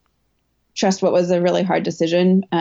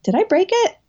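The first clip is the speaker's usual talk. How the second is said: alarmed self-quote; 12 semitones higher than usual. Retold or imitated. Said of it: "I was like".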